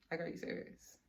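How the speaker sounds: nearly whispered, stunned repeat; hushed disbelief. Retold or imitated. Reacting to her friend's betrayal, hushed disbelief. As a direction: let the voice drop away to a whisper.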